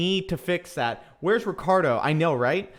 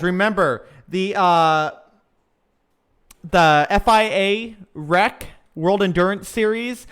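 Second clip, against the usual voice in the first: ordinary delivery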